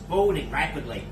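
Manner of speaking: ordinary talk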